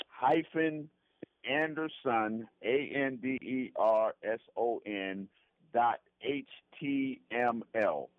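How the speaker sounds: slow dictation with gaps between each item; slow spelling-out. Real, not acted. Guest giving listeners his website address, slow spelling-out. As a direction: say it slowly, letter by letter. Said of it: "I'll say it slowly".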